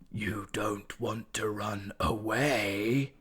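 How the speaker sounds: slow, airy, menacing whisper; a creature's voice coiling inside a cat's head; sinister Spider whisper. A narrator's reading. Spider voice again.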